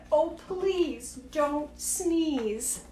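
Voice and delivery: drawn out, slow plea; slow pleading. Acted. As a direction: stretch every word, begging. Begging elephant not to sneeze.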